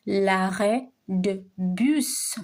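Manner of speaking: L'arrêt de bus drawn out slowly, rising on bus; slow model. Shown as a demonstration. Teacher slowly modelling the phrase for students. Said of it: "teacher models slowly".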